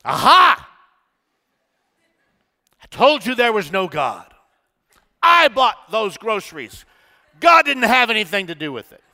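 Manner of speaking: loud, high mocking triumph with a laugh; gloating shout. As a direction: jump out and crow over her. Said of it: "he said".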